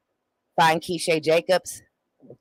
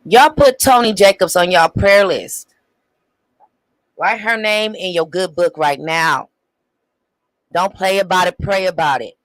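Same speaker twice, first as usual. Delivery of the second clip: loud, emphatic call to pray; urgent exhortation; 2 semitones higher, 8 dB louder than usual. Real, not acted. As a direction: urge them loudly, like rallying a congregation. Urgent appeal to viewers after clip.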